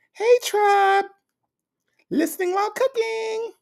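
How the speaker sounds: very high, airy sing-song greeting; V Lenore voice. Retold or imitated. Reading a viewer's comment aloud in her imagined high voice. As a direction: read it in a sky-high sweet voice like V Lenore. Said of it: "I wanna read it like V Lenore".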